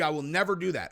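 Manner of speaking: ordinary talk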